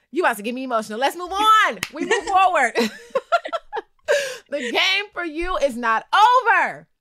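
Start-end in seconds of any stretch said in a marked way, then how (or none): 1.0-2.7 high, loud jokey call to move on; loud rallying. Real, not acted. Host to guest, about to get emotional, loud rallying. 4.5-6.9 rousing call. Acted. Host cheering eliminated player back into the game, rousing call.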